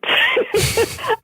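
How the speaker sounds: high, open peal of laughter; burst of laughter. Real, not acted. Telling the interviewer her name joke, bursting into high laughter. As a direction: let a big, high laugh break out.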